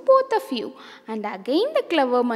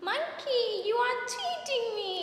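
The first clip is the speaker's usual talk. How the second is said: high, soft, tearful cat voice; a hungry cat crying to the monkey; 9 semitones higher, 10 dB quieter than usual. Acted. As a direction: whimper it out like a cheated little cat. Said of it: "These cats cried and said".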